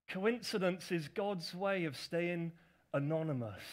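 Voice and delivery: each word laid out slowly; slow deliberate repeat of a favourite line; slow, savoured quote. Shown as a demonstration. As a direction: read it back slowly as a saying to savour.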